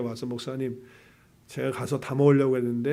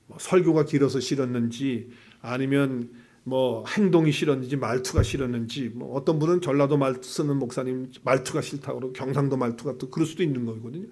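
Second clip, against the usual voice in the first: ordinary talk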